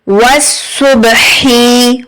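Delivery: सुबही slow, h from the middle of the throat. Shown as a demonstration. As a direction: breathe the h of सुबही from mid-throat, slowly. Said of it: "हा middle throat से".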